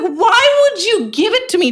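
high, drawn-out exasperated "why"; indignant protest. Retold or imitated. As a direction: wail it in disbelief, stretched out. Retelling her indignant protest to manager.

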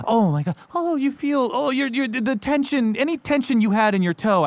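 gushing. Acted. Gushing over the warm spring.

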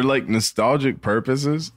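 usual speaking voice

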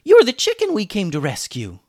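put-on high, loud boy's exclamation; thrilled shout of recognition; excited yell. A narrator's reading. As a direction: blurt it out loudly. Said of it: "yelled Julian".

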